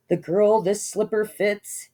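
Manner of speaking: slow, solemn royal proclaim; slow, solemn royal decree; grand proclamation. A narrator's reading. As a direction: announce it like a decree. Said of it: "he cried".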